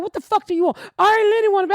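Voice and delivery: high, irritated snap; annoyed. Retold or imitated. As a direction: snap at the interruption. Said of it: "I was like".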